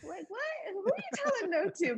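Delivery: high-pitched, mock-outraged challenge; incredulous scolding mom. Mom challenging her two-year-old for saying no, incredulous. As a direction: act out an affronted mother, pitch jumping up. Mother voiced scolding defiant toddler.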